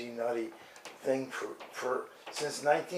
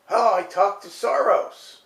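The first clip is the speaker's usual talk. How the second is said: mock TV host; 6 semitones higher, 9 dB louder than usual. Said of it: "She goes to the big meeting".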